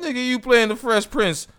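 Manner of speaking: high-pitched rapper impression. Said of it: "I'm sounding like [name]".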